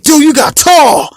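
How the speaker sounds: high-pitched, drawn-out astonishment; amazed dad. Retold or imitated. Dad seeing his grown son after years, amazed. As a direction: imitate a dad marvelling, high and slow.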